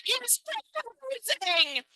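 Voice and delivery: high, slow, hushed giddy gasp; mock excited announcement. Comic tells the street crowd a man is proposing, hushed giddy. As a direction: breathe it out like big news. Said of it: "I shout out to everyone on the street".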